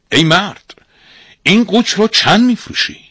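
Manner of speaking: high, loud hailing voice; accosting buyer. Acted. Disguised master hailing the ram's seller, forceful. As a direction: call out the question high and forcefully.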